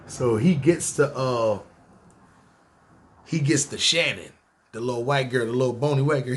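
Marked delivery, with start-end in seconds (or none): none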